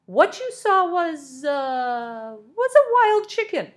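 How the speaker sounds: high, slow, strained voice; nervous foreman squeaking out a fib; flustered lie. A narrator's reading. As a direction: scramble for a lie in a squeaky pitch.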